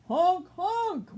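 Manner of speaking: loud honk honk like car horns. Acted. Cars honking at ducks crossing the road, loud horns. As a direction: blare it like speeding cars.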